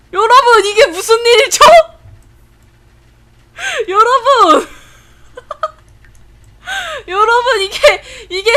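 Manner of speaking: high, loud laughing disbelief; cackling high cries of disbelief; laughing shriek. Real, not acted. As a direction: shriek through laughter at the absurd outfit. Laughing shock at bear costume.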